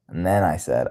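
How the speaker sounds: normal talking voice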